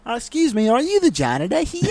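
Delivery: high, put-on polite woman's voice; mock the coach's sweet question; Australian swim coach asking politely, high; imitating the swim coach. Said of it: "she goes".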